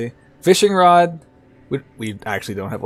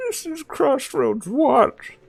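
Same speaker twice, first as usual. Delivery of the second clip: put-on old man voice; 13 semitones higher than usual.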